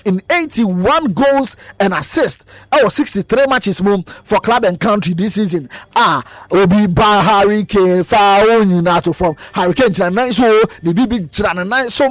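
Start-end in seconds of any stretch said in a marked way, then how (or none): none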